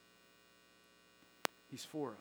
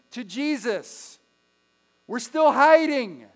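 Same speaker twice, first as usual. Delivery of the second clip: loud, higher preaching, slow on key phrases; preaching at full force; 6 semitones higher, 10 dB louder than usual. Acted. Preacher urging the church to share their faith, loud and rising. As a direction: build to the climax and hammer it in. Sermon climax on sharing faith.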